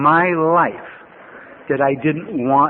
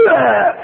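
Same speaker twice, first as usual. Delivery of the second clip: exasperated ugh; 7 semitones higher, 4 dB louder than usual. Retold or imitated. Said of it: "I'm like".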